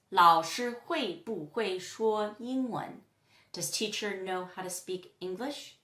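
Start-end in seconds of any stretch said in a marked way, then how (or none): 0.1-3.0 slow, measured Mandarin example; slow model question. Shown as a demonstration. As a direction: separate the syllables for learners. Teacher models a Chinese example question.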